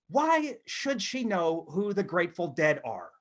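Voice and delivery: usual speaking voice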